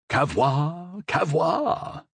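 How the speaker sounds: lightly mocking the captain's warning call, rising. The Cardinal playfully imitates his guard captain's warning call. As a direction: tease him by echoing his call. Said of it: "laughed the Cardinal, imitating his guard captain".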